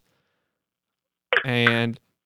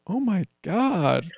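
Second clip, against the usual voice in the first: slow, high fake shock; mock surprise; 8 semitones higher, much slower than usual. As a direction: pretend to be shocked. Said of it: "And you go".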